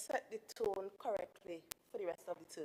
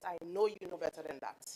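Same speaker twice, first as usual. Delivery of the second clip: ordinary talk, nothing marked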